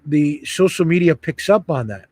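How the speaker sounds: ordinary talk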